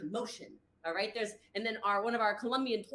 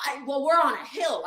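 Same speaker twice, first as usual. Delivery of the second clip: loud, high mocking complaint; mock blaming whine; 4 semitones higher, 8 dB louder than usual. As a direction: imitate someone making loud excuses. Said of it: "this person starts blaming".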